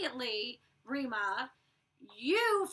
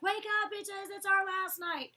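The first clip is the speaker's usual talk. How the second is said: high, drawn-out calling voice; mock wake-up call; 8 semitones higher, 3 dB louder, slower than usual. Roommate rousing the sleeping girls on the last night, high mock holler. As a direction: sing out a wake-up call to the house.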